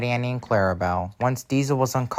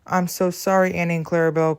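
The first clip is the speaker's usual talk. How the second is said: high, dismayed apology; distressed cry; 7 semitones higher than usual. Acted. Engine apologizing to derailed coaches, distressed cry. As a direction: cry it out pitched up, with mild distress. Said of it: "cried Stanley".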